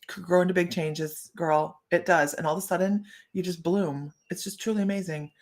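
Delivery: usual speaking voice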